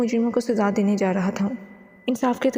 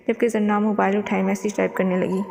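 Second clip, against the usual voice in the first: plain reading voice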